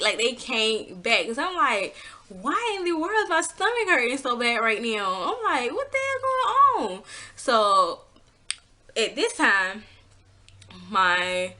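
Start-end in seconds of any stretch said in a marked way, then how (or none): 2.3-5.1 confused alarm. Retold or imitated. Confused about stomach pain. 5.7-7.0 high, sharp disbelieving cry; alarmed. Retold or imitated. She panics over the pain at night, high alarmed cry.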